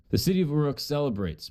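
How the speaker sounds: ordinary talk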